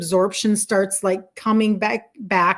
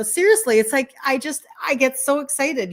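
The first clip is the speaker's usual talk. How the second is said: usual speaking voice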